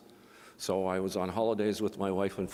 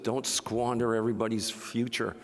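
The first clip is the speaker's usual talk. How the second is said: ordinary talk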